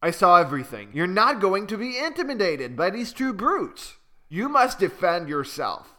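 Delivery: lightly voiced bold young bird; brave little bird. Okazu encouraging the frightened Malu, firm and lively. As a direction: urge her to stand up for herself.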